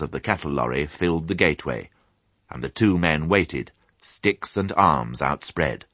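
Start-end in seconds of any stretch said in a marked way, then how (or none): none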